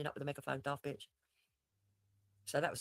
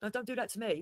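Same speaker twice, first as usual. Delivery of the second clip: mock embarrassed plea; 8 semitones higher, 4 dB louder than usual. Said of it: "you know, going".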